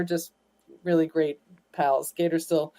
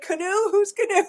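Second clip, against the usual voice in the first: mock puzzled; 14 semitones higher than usual. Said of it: "I was like".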